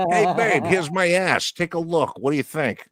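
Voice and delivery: high, playful put-on voice at +10 semitones; joking husband showing off to his wife; mock husband voice. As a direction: tease it like offering to the wife.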